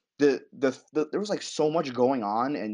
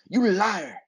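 mock spat insult; 8 semitones higher, 3 dB louder than usual. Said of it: "she spits".

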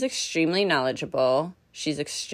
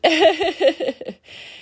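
bright laugh; 11 semitones higher, 4 dB louder than usual. Real, not acted. Laugh after praise.

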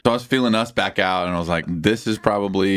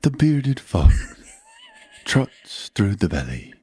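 slow, spaced-out documentary narration; nature documentary narrator; 2 semitones higher, slower than usual. Acted. Showing how Planet Earth differs, mock documentary narrator. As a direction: narrate wildlife with long dramatic pauses. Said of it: "Planet Earth is".

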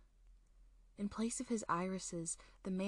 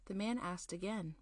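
ordinary delivery, nothing marked

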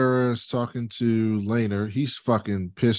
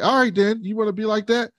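mock challenge; 10 semitones higher than usual. Voicing Rhaenyra's challenge.

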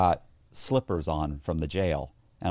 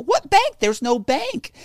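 mock disbelief; 6 dB louder, slower than usual. Feigned disbelief to agents.

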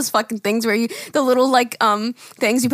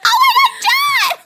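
high loud shout of the name; gleeful yell of the name; excited shout; 4 dB louder than usual. Retold or imitated. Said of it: "We're like".

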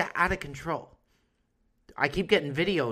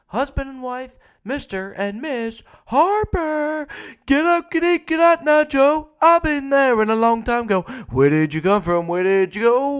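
much higher, loud hammed-up officiant; mock wedding announcer; 9 semitones higher, 9 dB louder than usual. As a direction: ham up a chaotic wedding emcee. Mock wedding scene in Paris.

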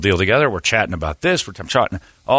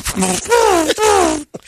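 high laugh. Real, not acted. Laughs after quote.

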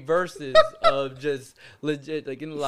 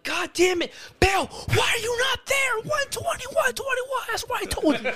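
very high, exasperated mock shout; mock yelling. Boss scolding Bill for being out of place, mock yelling. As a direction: holler orders in a hammed-up high yell. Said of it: "you're typically yelling".

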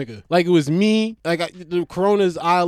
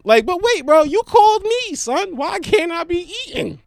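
high, loud indignant protest; 9 semitones higher, 4 dB louder than usual. Real, not acted. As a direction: protest being judged. Indignant reply to annoyed caller.

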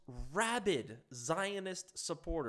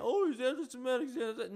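higher-pitched mocking whine of a complaint; mock Alexander Soros; 7 semitones higher, 4 dB louder than usual. Commentator mimics little Alexander Soros coming out with an accusation, mocking. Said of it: "little Alexander Soros coming out".

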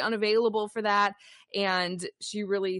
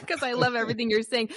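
holding back laughter while talking; stifled giggles; 3 semitones higher, faster than usual. Real, not acted. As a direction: giggles breaking through the words. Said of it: "I'm trying not to laugh too hard".

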